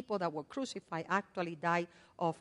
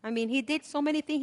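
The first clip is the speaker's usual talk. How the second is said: ordinary delivery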